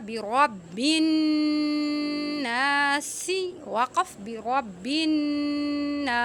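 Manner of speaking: slow chanted برب الناس, stopping on the س; Quran recitation. Shown as a demonstration. Teacher reciting the verse with a stop, slow chant. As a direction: recite slowly and pause on الناس. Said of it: "اگر آپ وقف کریں گے".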